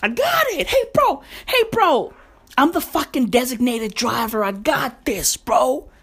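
put-on high, bragging kid voice; cocky little brother. Little brother bragging to family in the car, high cocky voice. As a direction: puff up like a know-it-all teen. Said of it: "and he's like".

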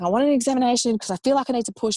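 retold pleading demand, rushed; urgent demand. Labouring mother demanding an examination from the obstetrician, urgent.

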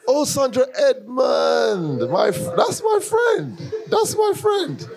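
real excited yell of recognition, laughing; excited shout. Real, not acted. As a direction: shriek with joyful recognition. Suddenly recognises her friend.

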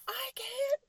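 put-on loud, high-pitched outburst at a child; high exasperated mom yell; exasperated freak-out. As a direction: reenact a mom losing it, yelling. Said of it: "I had a freak out".

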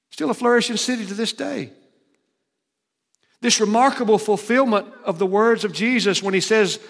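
preaching at full force. Acted. Preacher to the congregation about destroyed cities, booming. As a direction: drive home the ruin from the pulpit.

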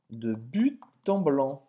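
ordinary talk, nothing marked

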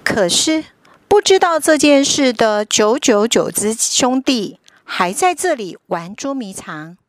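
ordinary delivery

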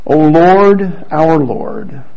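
normal talking voice

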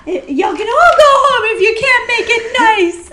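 high, loud, laughing Housewives quote; hammy reality star catchphrase; Dorinda impression. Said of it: "that was terrible".

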